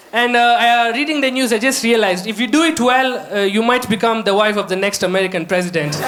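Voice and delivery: ordinary delivery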